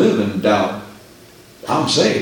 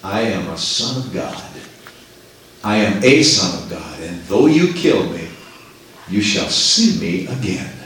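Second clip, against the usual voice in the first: calm, humble; 7 semitones lower than usual. Acted. Martyrs reply humbly to their killers, calm and low. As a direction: answer calmly, without fear. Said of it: "calmly and humbly replied".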